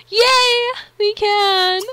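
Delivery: loud, high-pitched squeal of joy; excited cheer. Real, not acted. Gamer cheers at new ability.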